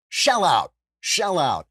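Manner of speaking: loud, high, punchy call to pay up; mock-bossy order, pitch dropping on OUT; mock demand. Host hustling listeners to subscribe, loud mock demand.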